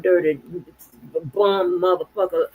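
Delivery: ordinary delivery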